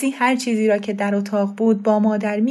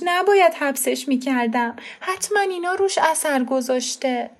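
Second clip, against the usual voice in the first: high, anxious pleading from a scared mother; high worried self-reproach; frightened mother; 8 semitones higher than usual. Acted. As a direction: fret aloud, blaming herself.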